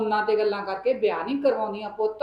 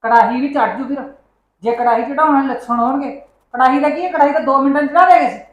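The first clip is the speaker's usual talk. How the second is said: loud, heated scolding, faster at the end; angry retort; 3 semitones higher, 8 dB louder, faster than usual. Acted. Mother shouting back at her son about the wedding, angry and loud. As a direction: fire back at him, voice rising.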